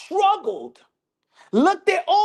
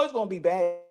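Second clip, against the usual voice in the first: usual speaking voice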